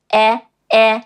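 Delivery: E, e as short, separate "eh" sounds; "eh" as in bed. Shown as a demonstration. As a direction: model E as the vowel of bed. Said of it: "as in bed".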